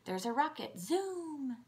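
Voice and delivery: playful kiddo-directed demo, high on Zoom; animated pointing game. Shown as a demonstration. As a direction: point things out with wide-eyed excitement. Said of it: "You can point to the different objects".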